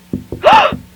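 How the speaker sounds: sharp, high gasp of fright; a sudden terrified intake of breath; frightened gasp. Acted. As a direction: gasp as someone looms at the window. Startled by a man at the car.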